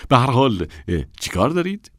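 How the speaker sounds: quick, high, rising question; impatient high rise on دارید. Acted. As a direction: hurry them, pitch up at دارید. Assistant asks women their business.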